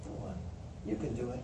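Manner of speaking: soft, low encouragement; low-voiced, understated pep talk; quiet attaboy. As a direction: drop right down and gently nudge them on. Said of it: "an attaboy".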